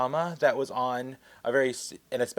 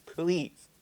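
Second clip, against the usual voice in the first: higher, softer mock-crying plea; retold tearful pleading; 4 semitones higher, 5 dB quieter than usual. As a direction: beg as if in tears. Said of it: "I'm crying".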